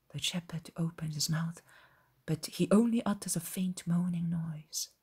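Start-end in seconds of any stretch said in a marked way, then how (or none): none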